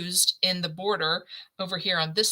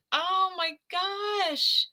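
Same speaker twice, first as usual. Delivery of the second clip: touched surprise; 11 semitones higher, much slower than usual. Real, not acted. Reading grandson birth news.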